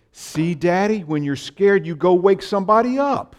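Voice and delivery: put-on high, loud little-girl voice with a laugh; bright child's punchline, giggling through it; little daughter's voice. Daughter telling Daddy her fix for fear, high and giggly. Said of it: "and she said".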